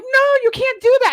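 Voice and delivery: frantic protest. Retold or imitated. Protesting to boss.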